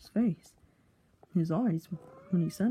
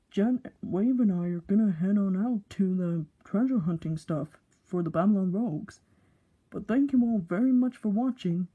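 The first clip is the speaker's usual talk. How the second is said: ordinary delivery, nothing marked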